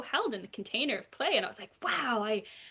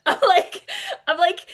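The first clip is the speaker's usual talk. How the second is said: laughing; 9 semitones higher, 9 dB louder than usual. Real, not acted. Laughing at the puppet story.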